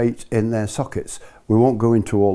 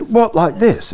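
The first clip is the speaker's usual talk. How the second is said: high, amused disbelief; laughing at the idea; surprised question; 10 semitones higher, 4 dB louder, slower than usual. Retold or imitated. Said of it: "and I said".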